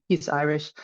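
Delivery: ordinary delivery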